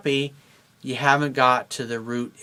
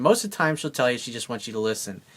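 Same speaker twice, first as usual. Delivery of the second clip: ordinary delivery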